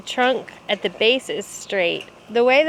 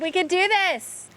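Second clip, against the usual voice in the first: pumped-up, higher cheer; hype man; 7 semitones higher than usual. Acted. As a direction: fire up the team. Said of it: "I'm just thinking hype man".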